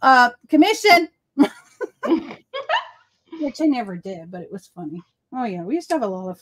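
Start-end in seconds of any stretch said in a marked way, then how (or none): 0.0-1.1 joking demand. Retold or imitated. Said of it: "I said".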